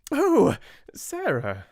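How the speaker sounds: high, slow strained exclamation; exclaiming Miss Minchin. A narrator's reading. Headmistress startled at the door, high strained exclaim. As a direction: force a surprised, polite cry. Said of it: "she exclaimed".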